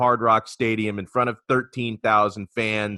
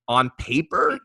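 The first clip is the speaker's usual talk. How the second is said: "On paper" set apart, higher, rising at the end; stress on "On paper"; 6 semitones higher, 3 dB louder than usual. Real, not acted. Host previewing the rivalry game to Paul, stressing "On paper". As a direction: lift "On paper" and let it hang. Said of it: "I emphasize the words".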